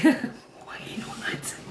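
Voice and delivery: breathy stunned whisper after a laugh. Real, not acted. Streamer catching her own joke, whispering. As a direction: drop to a whisper in disbelief.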